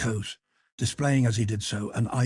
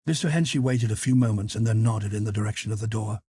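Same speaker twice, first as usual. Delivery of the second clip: ordinary delivery, nothing marked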